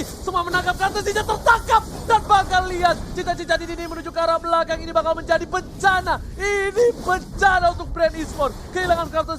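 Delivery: high, loud shouting caster; shouting play-by-play. Real, not acted. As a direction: yell the disaster call. Caster calls disaster pickoff.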